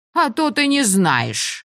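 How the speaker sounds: high, slow scoffing retort; scoffing pirate. A narrator's reading. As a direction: scoff it like a smuggler.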